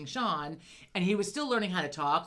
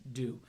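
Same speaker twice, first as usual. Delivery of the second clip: "Do" alone, the delayed last syllable of residue; word broken up; 6 semitones lower, 6 dB quieter than usual. Retold or imitated. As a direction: imitate a boy finishing his word late with a detached "Do". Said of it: "so he'd break it up".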